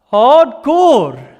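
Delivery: high, loud burst of amazement; loud exclamation. Real, not acted. Preacher reacts to God's tough message, exclaiming. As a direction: punch it out, stunned.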